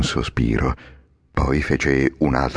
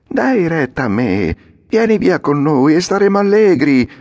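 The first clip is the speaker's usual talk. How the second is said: put-on high, louder boy's voice, coaxing; Lucignolo, tempting; 12 semitones higher, 5 dB louder than usual. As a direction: lure your friend to the fun. (Lucignolo tentatore, voce alta e allegra)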